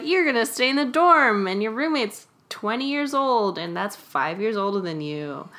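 lightly voiced chipper administrator breaking the news; mock college official.

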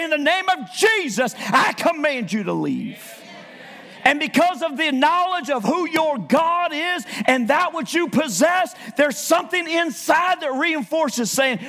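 fired-up sermon voice; impassioned preaching. Real, not acted. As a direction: preach it with force, punching key words. Preaches at full force.

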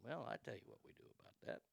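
fast conspiratorial whisper. Acted. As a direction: lean in and whisper it quickly. Conspiratorial setup before prank call.

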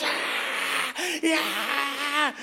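high, breathy imitated crowd wail; mock crowd cheer. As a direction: imitate the whole crowd shrieking. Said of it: "Y todos".

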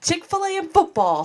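high-pitched mock Southern accent; put-on Southern accent. Cohosts mocking what the South is about, Southern accent. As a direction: play up a Southern football fan's accent.

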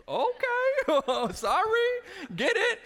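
high, loud laughing give-in; laughing lifeguard. Retold or imitated. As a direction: laugh and back off like a surprised lifeguard.

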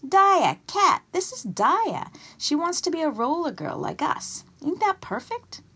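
very high-pitched holler, loud at first. A narrator's reading. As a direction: holler the introduction like an excited older woman. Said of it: "Bea hollered".

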